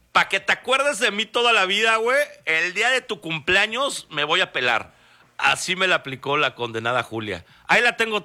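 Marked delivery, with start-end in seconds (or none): 0.1-4.9 higher mocking farewell from the dog; imagined dog's voice. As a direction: voice the dog teasing its owner. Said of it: "Así como de".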